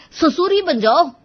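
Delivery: loud, high, exasperated punchline; angry outburst. A narrator's reading. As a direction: fling it at them in exasperation. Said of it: "अब आर्यन का पारा चढ़ गया". (गुस्से में ऊँची आवाज़)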